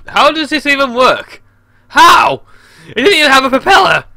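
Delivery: loud, high incredulous shout; shouty voice. Real, not acted. Gamer baffled by a plane with no propeller, incredulous shouting. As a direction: yell it in baffled disbelief. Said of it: "my shouty voice".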